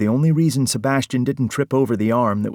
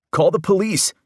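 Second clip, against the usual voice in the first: much higher, louder cry to call the police; screeching demand; 10 semitones higher, 4 dB louder than usual. Acted. Parker screeches at the others to call the police. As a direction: shriek it in panic. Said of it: "practically screeching now".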